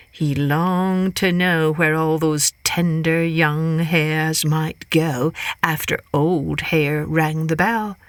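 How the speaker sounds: ordinary delivery, nothing marked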